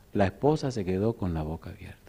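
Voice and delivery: hushed narrator whisper. Real, not acted. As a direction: drop to a breathless whisper.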